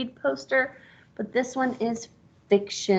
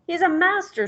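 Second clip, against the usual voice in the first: high, impressed pig voice; admiring pig; 9 semitones higher, 4 dB louder than usual. Acted. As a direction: praise him like an awed pig. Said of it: "said the pig".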